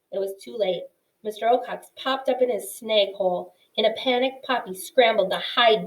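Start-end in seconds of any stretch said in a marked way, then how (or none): none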